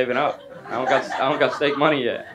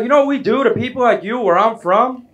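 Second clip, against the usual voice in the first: higher and louder, put-on menacing voice; threatening tough guy; 9 semitones higher, 5 dB louder than usual. Annoyed friend threatening the nosy comedian, hammed-up menace. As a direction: play the hammed-up street tough making a threat. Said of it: "he decided to threaten me".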